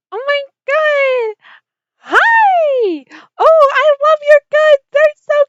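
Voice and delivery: very high, loud gushing squeal; excited squeal. Acted. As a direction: squeal with over-the-top delight at the goods. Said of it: "following her just as excited".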